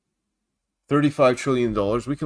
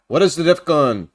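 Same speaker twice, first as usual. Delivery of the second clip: louder, announced question; projected question; 3 semitones higher, 6 dB louder than usual. Real, not acted. Host turns to answer a viewer's question, projected questioning. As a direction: put the question out loudly to the audience.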